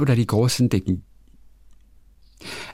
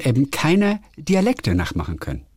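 ordinary talk, nothing marked

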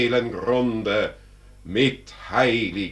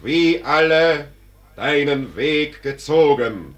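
strenger Einwurf; 6 semitones higher, 7 dB louder than usual. Acted. Tod weist den Tor zurecht.

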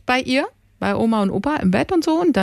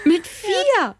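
astonished; 10 semitones higher than usual. Real, not acted. Astonished exclamation.

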